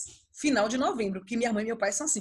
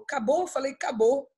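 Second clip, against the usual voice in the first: ordinary talk, nothing marked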